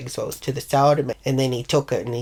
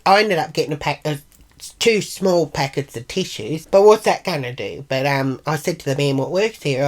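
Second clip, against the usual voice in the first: ordinary talk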